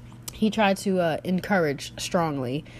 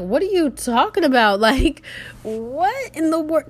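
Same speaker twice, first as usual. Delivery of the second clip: louder, higher, baffled outburst; drawn-out disbelief at nonsense; incredulous; 7 semitones higher, 5 dB louder, slower than usual. Retold or imitated. Her reaction to his accusation.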